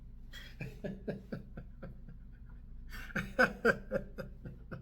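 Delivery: long, airy, unvoiced laugh of triumph; breathy laugh. Real, not acted. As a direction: laugh under your breath, delighted. Laughs at clip vindicating him.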